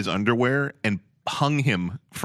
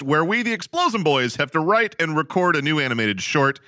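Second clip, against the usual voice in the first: high, bright host intro voice; announcer; 6 semitones higher than usual. Acted. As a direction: open the show like a host.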